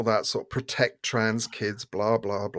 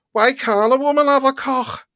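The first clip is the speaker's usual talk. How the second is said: much higher mock protester voice; higher mocking impression of a protester; 12 semitones higher, 4 dB louder than usual. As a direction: lampoon a shrill protester, pitched way up. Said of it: "Like this".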